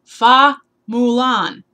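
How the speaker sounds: proudly announcing her pick; drawn-out announcement. Real, not acted. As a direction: belt out the name. Naming her favorite princess.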